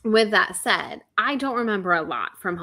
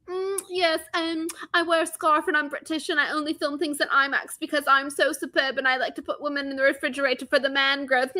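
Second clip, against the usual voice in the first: higher, affected British posh voice mocking a director; snooty British impression; 6 semitones higher than usual. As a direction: sneer through it as a pretentious British filmmaker. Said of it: "was like".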